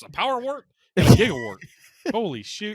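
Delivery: high, fast, amused delivery; amused excited. Real, not acted. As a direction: blurt it out gleefully. Laughing over silly word.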